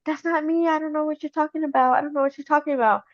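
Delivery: high, rushed denial; high-pitched protesting, words tumbling out fast; mock protest. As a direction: imitate a wife pleading innocence. Voicing the accused wife's denial.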